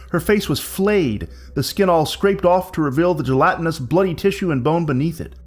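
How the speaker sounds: plain reading voice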